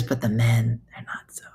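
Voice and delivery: imitated old lady's hushed aside; retold whispered gossip; confiding whisper. Retold old lady confiding about men.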